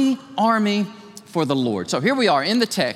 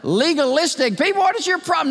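mock angry retort; 9 semitones higher than usual. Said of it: "get mad, say".

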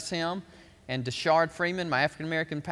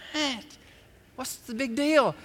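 mock bafflement; 8 semitones higher than usual. Acting out clueless men's reaction.

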